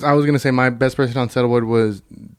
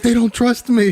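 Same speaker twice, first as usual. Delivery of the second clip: higher, worried mock quote; imitating the character freaking out; panicked Barry; 8 semitones higher, slower than usual. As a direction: squeak it like a nervous car thief. Quoting Barry's panicked line.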